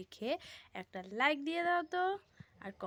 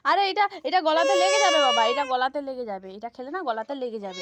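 louder worried warning; 8 dB louder than usual. Real, not acted. Warning child over crying.

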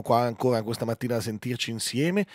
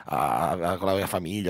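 sceptical TV executive; 8 semitones lower, 5 dB quieter than usual. Retold or imitated.

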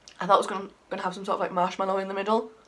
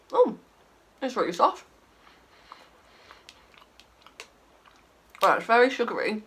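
mouth full; 3 semitones higher than usual. Real, not acted. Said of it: "awful noises I make when I eat".

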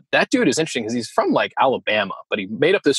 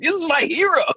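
gushing fan. Retold or imitated. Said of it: "I'm like".

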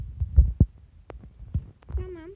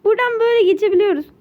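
surprised; 5 semitones higher, 7 dB louder than usual. Real, not acted. Finds way through.

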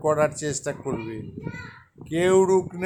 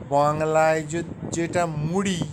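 ordinary delivery

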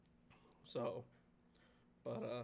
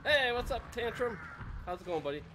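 excited; 8 semitones higher than usual. Real, not acted. Greeting a pet.

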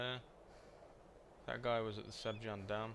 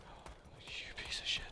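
hushed breathy whisper of disgust; whispered curse. Retold or imitated. Player imitating his own reaction to the glitch, whispered curse. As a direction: breathe the insult under your breath. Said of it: "It's like".

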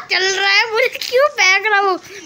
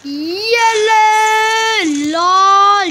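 drawn-out shout; 3 semitones higher, 5 dB louder, much slower than usual. Real, not acted. Gamer yelling as he unleashes his attack, stretched shout. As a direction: belt it out slowly as you fire.

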